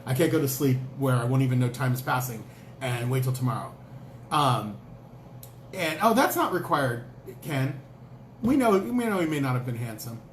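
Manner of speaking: ordinary talk, nothing marked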